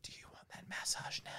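hushed unvoiced offer; creepy whisper. Teller mocks the host's creepy offer, whispering.

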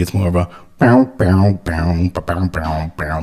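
bouncy "bow bow" bass line; humming bass line. Shown as a demonstration. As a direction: hum a light comedic bass line. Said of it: "bass line and tones like this".

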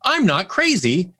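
high, slow, emphatic retold realization; relieved realization. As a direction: stretch it out high, as a revelation. Retold relieved reply to doctor.

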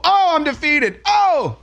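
high mock-defeated voice, dripping sarcasm; sarcastic surrender. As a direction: play the beaten man with heavy irony.

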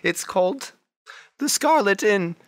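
high, bright-voiced lady shopkeeper. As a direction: gush over their outfits in a high lady voice.

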